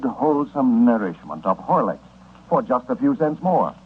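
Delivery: usual speaking voice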